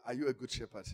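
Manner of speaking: ordinary talk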